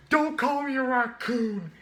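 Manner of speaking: high, loud, slow furious yell; angry Rocket shout. Retold or imitated. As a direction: snap back at an insult. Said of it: "he was like".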